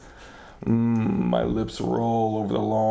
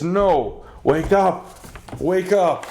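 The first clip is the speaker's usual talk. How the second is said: higher and louder, desperate commands; frantic shout; 7 semitones higher, 5 dB louder, slower than usual. Acted. Shaking the body, begging him to wake, frantic shouting. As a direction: yell him awake in panic. Tries to rouse Santa.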